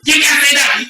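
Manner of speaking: high-pitched mock indignation; naughty little Rinpoche. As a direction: squeal a protest like a small boy. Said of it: "a little naughty one, small one".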